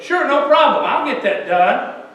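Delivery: breezily promise, as if imitating a kid; agreeable kid. Kid placating the teacher, mock agreeable. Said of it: "and the kid goes".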